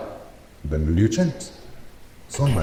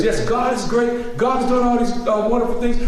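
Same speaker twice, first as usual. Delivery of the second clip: mock churchy praise; 11 semitones higher than usual. Mocking how folks praise God at church, high and sugary. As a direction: lay on the holy talk sweetly.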